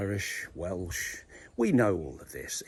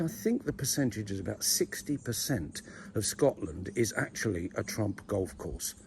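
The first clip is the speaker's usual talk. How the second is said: ordinary delivery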